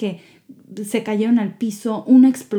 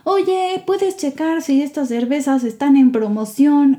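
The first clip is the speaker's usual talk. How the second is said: mock call across the store, higher and louder; retold shout across the shop; 6 semitones higher, 3 dB louder than usual. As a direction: call out to a coworker at the back. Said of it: "le grita a su compañero".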